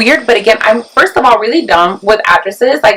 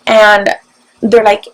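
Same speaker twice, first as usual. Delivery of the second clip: normal talking voice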